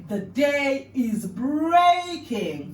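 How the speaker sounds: "The day is breaking" stretched very slowly and loud; slow emphatic demo. Shown as a demonstration. Teacher modelling the phrase very slowly. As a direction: draw out every word of "The day is breaking". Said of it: "some body movements to incorporate".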